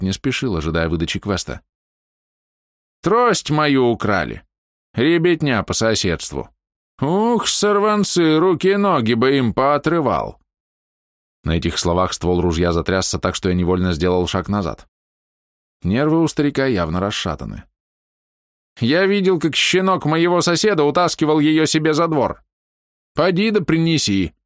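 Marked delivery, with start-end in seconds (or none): 3.0-10.4 put-on slow, high, furious old man; angry old man. Old man rants about stolen cane. 18.8-24.3 put-on high, bossy old man. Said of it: "Старик стволом указал".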